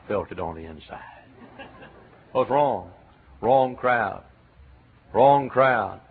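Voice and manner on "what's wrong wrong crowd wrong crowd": normal talking voice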